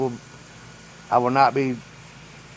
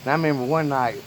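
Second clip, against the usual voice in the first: normal talking voice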